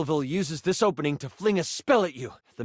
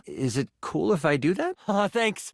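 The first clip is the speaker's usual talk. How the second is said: normal talking voice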